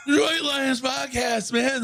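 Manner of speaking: high, slow slurred shout; drunk shout. Retold or imitated. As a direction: shout it like a tanked fan.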